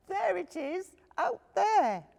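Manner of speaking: high, loud little cub voice; a small bear cub thanking sweetly. Acted.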